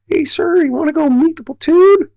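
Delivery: very high falsetto impersonation; squeaky-high put-on voice; highest voice. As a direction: imitate him way up in pitch. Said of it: "he's got the highest voice".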